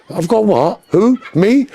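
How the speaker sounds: high, feigned surprise; mock innocent Londoner. Imitating London criminals denying it, high and surprised. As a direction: play dumb like a London crook. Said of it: "London, they go".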